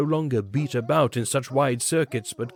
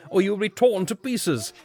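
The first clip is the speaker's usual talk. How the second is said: earnest warning; 8 semitones higher than usual. A narrator's reading. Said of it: "said the one I knew very earnestly".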